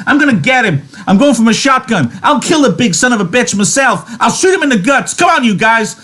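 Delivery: furious shout. A narrator's reading. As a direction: rage and rally the men. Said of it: "He worked himself into a fury".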